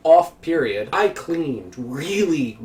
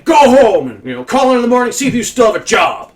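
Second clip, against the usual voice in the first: much higher, louder dismissal; angry boss; 9 semitones higher, 10 dB louder than usual. Retold or imitated. Supervisor sending the worker home, high loud anger. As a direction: send him home like a furious boss. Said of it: "He tells me".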